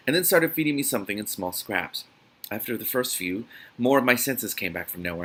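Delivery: plain reading voice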